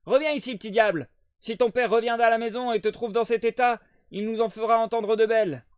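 crier, nettement plus aigu et plus fort; cri de mère. Acted. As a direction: gronder le gamin à pleine voix. Mère grondant l'enfant en criant. (mère furieuse qui crie après Jack)

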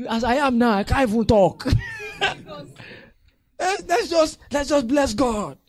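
loud, high, shouting then laughing; loud hammed-up overjoyed member; mock overwhelmed. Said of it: "member overwhelmed by blessing".

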